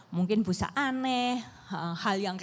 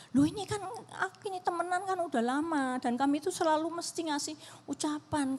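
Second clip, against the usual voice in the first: a higher, lightly voiced upset daughter; imitating her daughter; 8 semitones higher than usual. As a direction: slip into the hurt child's voice, pitched up. Mother retells daughter's complaint. (menirukan suara anak yang kecewa, lebih tinggi)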